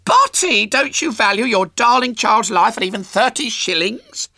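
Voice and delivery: very high, loud theatrical reproach; outraged wife. A narrator's reading. Mother shaming husband over the reward, outraged. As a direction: cry out in hammed-up indignation.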